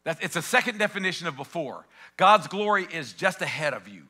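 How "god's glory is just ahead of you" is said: "God's glory" leaned on, louder, stressing each word; emphatic first take. Real, not acted. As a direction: proclaim it to the room. Repeats line, first take emphatic.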